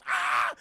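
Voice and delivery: loud acted cry of pain; short mock howl of pain; retold pain yell. Man whose hand Arnold breaks, loud mock yell.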